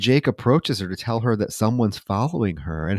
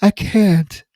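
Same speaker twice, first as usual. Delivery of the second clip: slow, higher put-on voice; mock woman's refusal; 8 semitones higher, much slower than usual. Host mimicking the woman pulling away, slow mock voice. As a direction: drag out a breathy mock refusal. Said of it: "before she's like".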